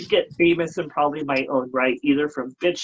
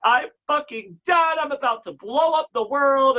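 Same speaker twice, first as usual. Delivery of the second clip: exasperated; 8 semitones higher than usual. Retold or imitated. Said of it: "I'll be like".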